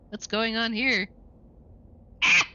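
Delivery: ordinary delivery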